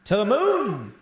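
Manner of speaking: very high, loud whoop; excited cheering. Real, not acted. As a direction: cheer like the numbers are soaring. Celebrating seven listeners.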